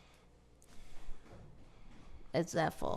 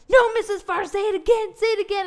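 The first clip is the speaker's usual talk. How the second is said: put-on excited children; 10 semitones higher, 8 dB louder than usual. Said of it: "the children".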